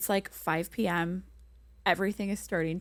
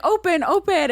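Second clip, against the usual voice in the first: high loud open open; pleading; 11 semitones higher, 8 dB louder than usual. Retold or imitated. Stranded tourist pleading at the locked door, loud high begging. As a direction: beg them to open up.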